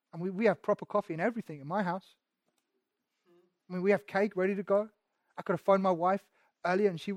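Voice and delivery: fast, muttering complaint, lightly voiced; mock grumbler. A hint of a jealous crowd member grumbling about Jesus, quickly. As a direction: rattle it off like a sulky churchgoer. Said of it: "They grumbled".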